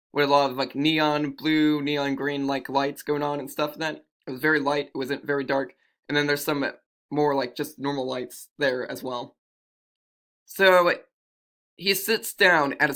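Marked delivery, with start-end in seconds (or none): none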